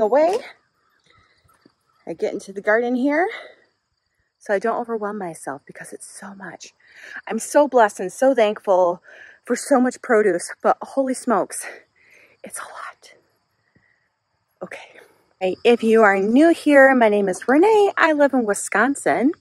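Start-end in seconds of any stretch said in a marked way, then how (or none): 4.4-6.7 whispered aside. Real, not acted. Whispered aside while gardening. 12.4-15.0 whispered aside. Real, not acted. Whispered aside about produce.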